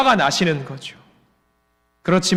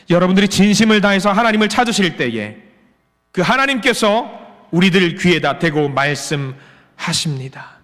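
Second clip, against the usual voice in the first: usual speaking voice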